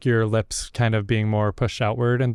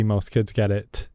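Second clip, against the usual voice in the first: usual speaking voice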